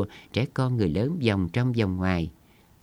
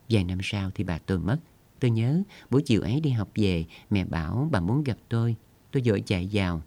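ordinary delivery, nothing marked

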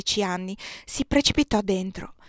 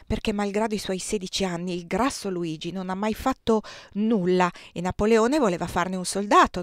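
ordinary delivery, nothing marked

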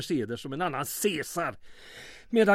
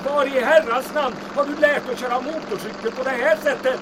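higher, louder yell over the engine; shouting over wind; 8 semitones higher, 5 dB louder than usual. Acted. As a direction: call out above the rushing wind.

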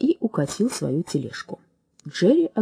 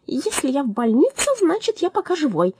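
a lively parrot voice, raised; chirpy higher bird voice; 7 semitones higher than usual. A narrator's reading. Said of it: "догадался Джерри".

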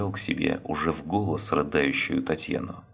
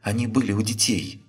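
higher, frightened, changed voice; 6 semitones higher than usual. Acted. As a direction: horror breaking through. Terrified wife realizing danger.

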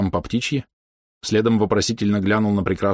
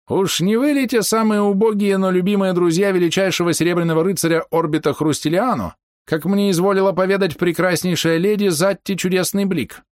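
put-on old man voice; 10 semitones higher than usual. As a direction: chatter like a fussy old man.

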